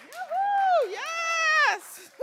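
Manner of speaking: high whooping cheer to the crowd; rally the team with a whoop. Real, not acted. Resident rallying her supporters, high cheer.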